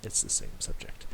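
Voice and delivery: whispered aside; soft whispered afterthought. Real, not acted. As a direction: breathe it out under the voice. Trails off at video end.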